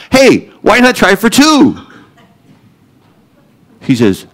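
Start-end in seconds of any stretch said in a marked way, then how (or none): 0.1-1.8 cocky hopeful. Retold or imitated. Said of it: "So I thought".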